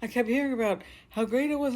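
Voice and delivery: normal talking voice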